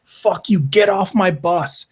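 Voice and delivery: hammed-up yelling boss throwing him off the bus; mock angry rant. Dane yelling, raised pitch.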